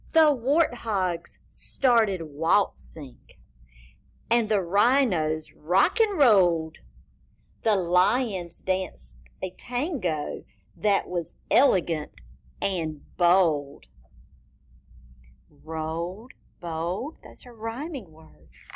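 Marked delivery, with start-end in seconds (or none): none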